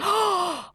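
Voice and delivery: a sharp, high mock gasp. Reacting to her co-host's correction, mock gasp. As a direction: gasp as if scandalized. Mock shock at misused phrase.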